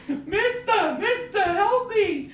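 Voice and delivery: put-on high, slow squeaky old lady pleading; old lady cry for help. Podcaster quoting the old lady from the movie, high and pleading. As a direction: play the helpless old woman calling out.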